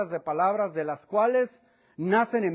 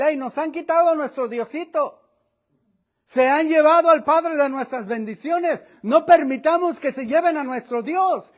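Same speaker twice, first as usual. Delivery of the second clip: raised, higher, louder rallying cry; alarmed call to neighbours; 6 semitones higher, 6 dB louder than usual. Acted. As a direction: rouse the neighbours, indignant. Micaía calls out to neighbours. (llamado alarmado y fuerte a los vecinos)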